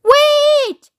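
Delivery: high, drawn-out loud cry; piercing alarmed halt; scream. A narrator's reading. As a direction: scream for everyone to stop. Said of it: "she screamed".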